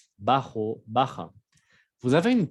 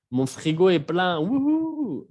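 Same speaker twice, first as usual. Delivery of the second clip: louder, higher cheer over a full fridge; mock delight; 8 semitones higher, 5 dB louder than usual. Shown as a demonstration. Teacher acting out joy at a full fridge, hammed-up. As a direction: celebrate the full fridge with a whoop.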